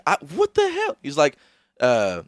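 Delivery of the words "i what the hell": much higher squeaky outburst; high pitched mock indignation; squeaky mock outrage. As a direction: squeak in disbelief.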